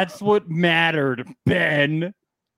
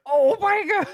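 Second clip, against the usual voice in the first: mock shock; 3 dB louder than usual. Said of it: "It was like".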